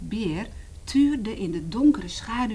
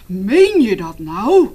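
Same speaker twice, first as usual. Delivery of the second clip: surprised bear; 11 dB louder, slower than usual. Acted. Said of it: "vroeg de beer".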